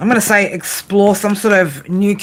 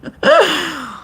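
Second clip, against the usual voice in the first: laughing; 9 semitones higher than usual. Real, not acted. Reacting to cheeky chat comments.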